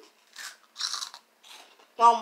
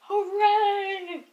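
cheering; 10 semitones higher than usual. Real, not acted. Cheering the winner.

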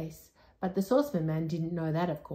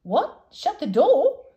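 much higher, louder, baffled question; a deaf old man mishearing, startled; mishearing surprise; 11 semitones higher, 11 dB louder, slower than usual. Acted. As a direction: shout the misheard question up high. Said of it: "said the saucepan man".

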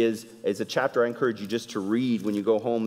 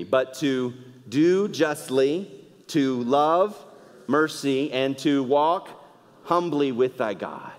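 slow, deliberate unison reading; slow recitation; 2 semitones higher, slower than usual. Shown as a demonstration. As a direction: lead the congregation, word by word. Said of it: "But say it with me".

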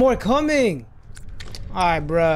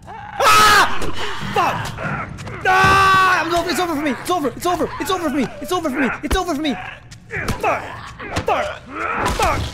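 shrill panicked wail, pitched up; panicking gamer; 5 semitones higher than usual. Real, not acted. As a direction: cry out that it's over, panicking. Attacked again, wailing it's over.

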